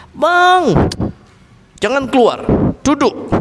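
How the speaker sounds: a wife commanding her husband to sit; demanding wife. Retold or imitated. Wife stopping her husband from leaving, high and insistent.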